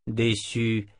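ordinary delivery

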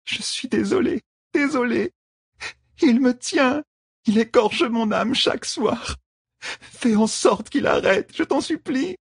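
high, slow, broken pleading; under torture. A narrator's reading. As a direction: beg slowly in a high voice. Tortured woman begs Rand.

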